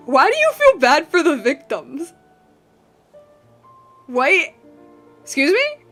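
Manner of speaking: usual speaking voice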